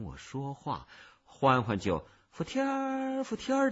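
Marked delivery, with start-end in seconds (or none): 2.3-3.7 excited calling out. A narrator's reading. Little cicada spotting its owner, calling out. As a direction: call the name out in a high voice. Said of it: "的大叫起来".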